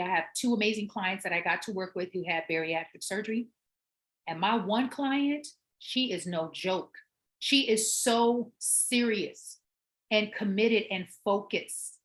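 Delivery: normal talking voice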